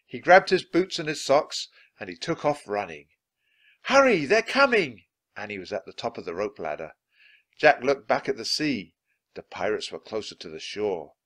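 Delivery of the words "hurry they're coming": high, loud warning cry; raised, high-pitched alarm; urgent calling out. A narrator's reading. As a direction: call a frantic warning from a distance. Annie calls urgently from rope ladder.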